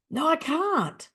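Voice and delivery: high-pitched mock whine; helpless protest. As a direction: imitate a client insisting it's impossible. Client protests again, retold.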